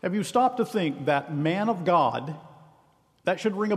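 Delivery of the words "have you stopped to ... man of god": ordinary talk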